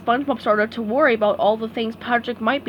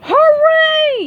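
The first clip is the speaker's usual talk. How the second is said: very high, loud cheer; yelling hooray; 9 dB louder than usual. A narrator's reading. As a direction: whoop with joy. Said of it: "yelled Patrick".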